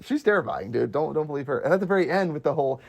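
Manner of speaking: usual speaking voice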